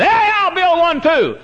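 very high, slow mock of a careless builder; hammed-up eager show-off; mocking rash builder. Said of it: "he just jumped into it and said".

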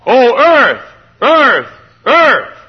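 high, loud holler on each word; urgent cry. Acted. Prophet calling to the earth, urgent loud cry. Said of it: "there's an urgency about it".

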